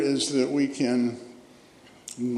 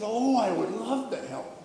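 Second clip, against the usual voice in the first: lightly voiced little girl, pitched up; a hint of a delighted child's higher voice; eager little girl; 7 semitones higher, 4 dB quieter than usual. Retelling excited little girl's eager reply.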